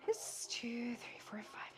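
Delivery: rhythmic shushing. Shown as a demonstration. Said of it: "Loud shish".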